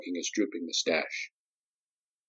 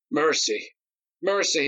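higher, falling cries of mercy; pleading; 6 semitones higher than usual. Acted. Cowering Nadi begs Darius for mercy, rising higher. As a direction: beg again, each plea higher. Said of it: "he pleaded".

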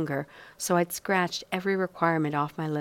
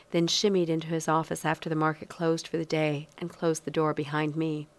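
usual speaking voice

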